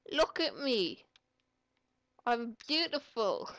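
loud delighted showing off; excited shout. Real, not acted. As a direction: call out proudly. Riding bicycle as a goat.

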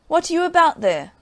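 loud, high call from horseback. Acted. Farmer pulls up and calls to the soldier on the ground, loud and high. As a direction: call it out sharply as you rein in.

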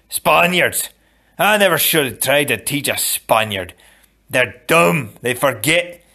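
furious teacher yelling at a pupil; berating teacher. Acted. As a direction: drill the lesson into him angrily.

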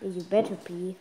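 low, soft grumbled retort; muttered warning. Real, not acted. Child answering mom's apology, low grudging warning. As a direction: grumble it back under your breath.

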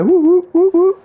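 high, mocking owl hoots; owl hoot imitation. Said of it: "hear a fucking owl go".

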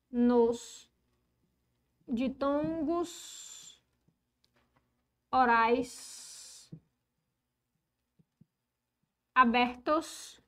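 stretched words with long pauses between; drawn-out, isolated words, board dictation. Real, not acted. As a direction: dictate slowly as you write. Dictates while writing the rule.

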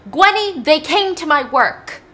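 loud, high, acted outburst; angry yelling. Dad confronts Gwenny about his work, acted angry yell. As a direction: yell the name, then drag out the accusation. Father yelling while beating daughter.

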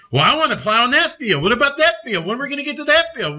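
high, fast whiny bull pestering; a bull nagging to plow the far field; impatient bull. Retold or imitated. Bull nagging the farmer in a high, fast whine. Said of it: "the bull saying".

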